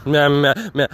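exasperated cat breaking off its song; sick and tired cat. Acted. Cat quitting the theme song, exasperated. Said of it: "who's just really sick and tired".

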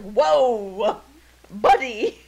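loud, drawn-out reaction to a cross cat; mock taken aback. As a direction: act startled, warning the cat off. People startled by the angry cat.

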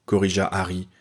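ordinary delivery, nothing marked

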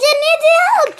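high, loud protest; urgent call. A narrator's reading. Dogs pleaded for during storm.

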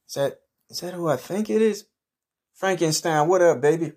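put-on high, excited hey to an old friend; thrilled to spot him; excited greeting. Man greeting Frankenstein at the party, high and excited.

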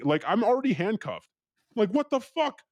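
high, reenacted incredulous protest; retold outrage rising very high; exasperated disbelief. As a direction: protest helplessly, pitch climbing. Protesting while handcuffed at gunpoint.

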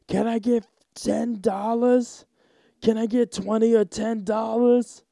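high, slow, pushy begging voice; aggressive homeless guy. Retold or imitated. Comedian imitating homeless man demanding money, high and aggressive. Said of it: "a homeless guy that was like".

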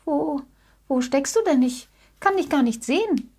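lightly voiced searching little bear; calling out. A narrator's reading. Little bear searching for the unseen rabbit, mild call. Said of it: "rief Jackie".

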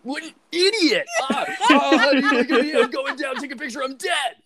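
high, laughing comic rant; mock laughing rant. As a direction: cackle through it like a gleeful fool. Acting out the funeral scene.

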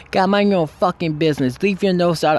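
high-pitched imitation of a scolding mom; mock telling-off, pitched well up; retold angry scolding. Mom tells the storyteller to back off, retold angry scolding.

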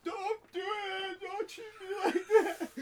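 very high, drawn-out yell; hammed-up shriek of alarm; mock panicked protest. Everybody reacting to the consoling, mock high shouting.

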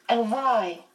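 short, high-pitched challenge; demanding child. A narrator's reading. Charles pressing for a reason, in a high, demanding voice. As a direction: demand the reason like a small boy. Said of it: "Charles Wallace demanded".

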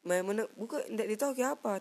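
usual speaking voice

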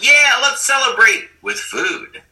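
high, loud, slow hammed-up glee; gleeful mock. Himself urging his wife to celebrate with food, hammed-up glee. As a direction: sell the treat with mock delight. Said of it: "I'd be like".

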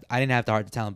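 usual speaking voice

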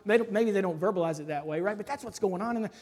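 ordinary talk, nothing marked

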